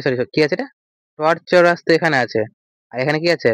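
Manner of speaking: ordinary talk, nothing marked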